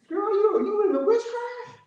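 high, incredulous teasing; mock disbelief. Preacher teases a friend who burns sage, with high mock disbelief. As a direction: act shocked at the friend.

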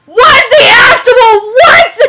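high, loud, drawn-out disbelieving yell; aghast high-pitched shout; shocked outburst. Real, not acted. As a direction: yell it slowly in shrieking disbelief.

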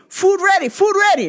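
high, clipped imitation of the Thai restaurant clerk's accent; mock Thai accent. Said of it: "they call me".

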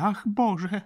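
high, loud exclamation of dismay; horrified cry. A narrator's reading. Baroness appalled at the sight of the lodger, crying out. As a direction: cry out in horror. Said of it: "krzyknęła, odwracając głowę".

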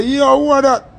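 high, loud startled cry of alarm; startled yell. Retold or imitated. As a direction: jump and call out at the sight of the gun. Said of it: "And me turn around and say".

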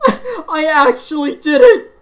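high, loud burst of laughing disbelief; stunned joy. Real, not acted. Gamer realizing his full combo, laughing loud and high. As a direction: let it spill out in a giddy laugh. Disbelief after finishing hard song.

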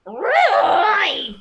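high, very slow, cackling shout of a witch; loud hunched witch, laughing and wailing. Acted. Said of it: "it sounds like this".

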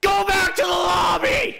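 high, loud furious yell at a rival player; raging shout. Real, not acted. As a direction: scream it out after he steals your kill. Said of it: "Sorry for my rage there".

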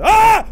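high, very loud shriek; real scream of fright; startled scream. Real, not acted. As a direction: cut yourself off with a scream. Jump scare.

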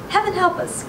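high-pitched cry of alarm; frightened cry. A narrator's reading. Mother clutching her husband, frightened high cry. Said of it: "cried the mother".

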